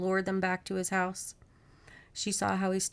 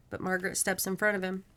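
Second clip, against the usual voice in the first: plain reading voice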